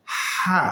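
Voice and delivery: "হা" from the middle of the throat, air first, then voice; throaty ha. Shown as a demonstration. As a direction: let breath lead into "হা". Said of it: "আগে বাতাস". ("হা" গলার মাঝখান থেকে, আগে বাতাস পরে আওয়াজ)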